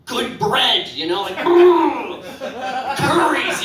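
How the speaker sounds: high, loud shivery swoon; horny lady swoon. Pretend. Comic voicing a lady lusting over Ethiopians, high brr.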